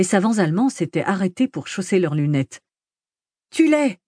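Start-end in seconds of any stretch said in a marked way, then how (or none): 3.5-4.0 very high-pitched cry; shouted egging-on. A narrator's reading. Kid eggs on the madman in a brawl, high shout. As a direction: shout it high to goad a fighter. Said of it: "cria le gamin".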